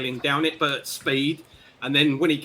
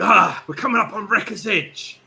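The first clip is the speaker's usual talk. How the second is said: raised, higher voice, calling out the landfall; sailor's hearty announcement; 7 semitones higher, 7 dB louder than usual. Acted. Flynn tells the crew they are nearing port, calling out. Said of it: "And he says to you".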